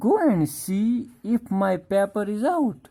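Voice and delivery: slow, deliberate reading of the text; reading the story line by line; slow reading aloud. Shown as a demonstration. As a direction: read each word out slowly for the class. Rereads father's line.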